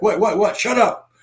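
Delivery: high, urgent what what what then shut up; hammed-up jittery retort, pitched high; mock alarm. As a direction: snap back in mock panic. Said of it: "you're like".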